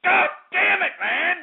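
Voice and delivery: high, strained rant. Acted. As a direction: shout it high at the callers. Host ranting at callers.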